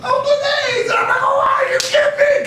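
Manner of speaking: very high, loud, disbelieving cry; stunned joyful shout. Retold or imitated. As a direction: shout in amazed delight. Drafted by his dream team.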